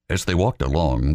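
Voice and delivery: usual speaking voice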